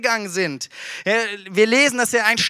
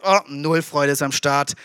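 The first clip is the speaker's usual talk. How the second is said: mock glum voice; 8 semitones lower than usual. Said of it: "eins ist".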